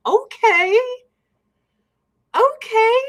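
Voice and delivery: high, loud, rising disbelief; retold taken-aback reaction. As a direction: act thrown, pitching right up into a question. Retold reaction to guide's rant.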